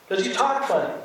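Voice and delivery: imitating a teasing local; retold teasing remark. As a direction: playfully point out their odd speech. Retold teasing exchange about accents.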